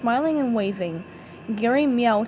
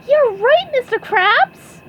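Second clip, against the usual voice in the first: much higher, loud eager cry; excited; 13 semitones higher, 7 dB louder, slower than usual. Acted. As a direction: burst with new resolve. Snaps out of depression.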